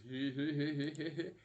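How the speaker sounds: villain's giggle of triumph; hammed-up cartoon snicker; acted gleeful cackle. Plankton receives the secret formula, hammed-up cackle. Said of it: "And then he goes".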